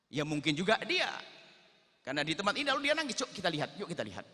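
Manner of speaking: eager urging. Acted. Umar urges "let's go look.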